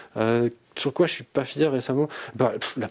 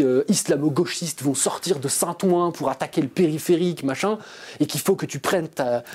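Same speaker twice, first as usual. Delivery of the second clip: mock alarmist; 3 semitones higher than usual. Mocking far-right fears of invasion.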